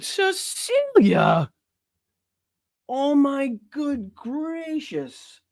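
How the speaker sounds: high, slow, drawn-out gasp of amazement; mock-overwhelmed slow high exclamation; astonished. Real, not acted. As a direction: stretch it out, floored by the gift. Reacting to a big donation.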